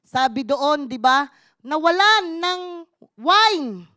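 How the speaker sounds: ordinary delivery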